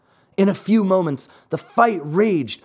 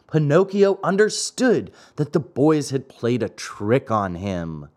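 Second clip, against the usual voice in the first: plain reading voice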